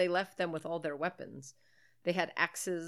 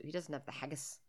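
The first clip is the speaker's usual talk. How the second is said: unvoiced whisper; 3 semitones lower, 9 dB quieter than usual. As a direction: whisper the punchline. Whispered joke punchline.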